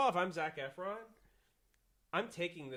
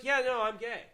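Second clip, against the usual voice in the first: eager; 8 semitones higher, 8 dB louder than usual. Pretend. Hypothetical excuse.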